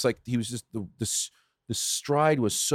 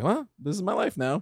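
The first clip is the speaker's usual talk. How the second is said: higher, lightly voiced resignation; resigned acceptance; 7 semitones higher, 4 dB quieter than usual. Dad accepting his son beats him, resigned. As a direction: give in with a small, lifted voice.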